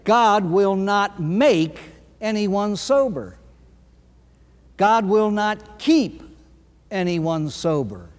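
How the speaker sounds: very slow, each phrase weighted; deliberate pulpit emphasis; slow emphatic preaching. Real, not acted. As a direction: lay down the law slowly.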